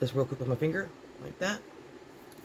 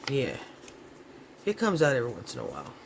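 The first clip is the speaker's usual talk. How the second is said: ordinary talk, nothing marked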